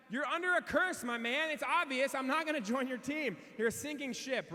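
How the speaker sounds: passerby refusing to join the cursed team; mock stranger. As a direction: tease them as doomed. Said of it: "they were like".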